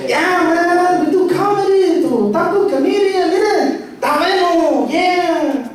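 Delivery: high, excited Spanglish uncle voice; over-the-top eager uncle, high all through; enthusiastic uncle. Retold or imitated. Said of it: "I love the enthusiasm".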